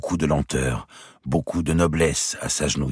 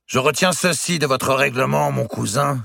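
higher and louder, a challenge to his cousin; Robert's defiant cry; 11 semitones higher, 3 dB louder than usual. A narrator's reading. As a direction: seize the ruling and turn it against him. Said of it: "s'écria-t-il".

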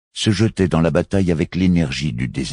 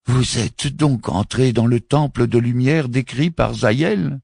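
astonished question; 9 semitones higher than usual. A narrator's reading. Mufti asking, astonished.

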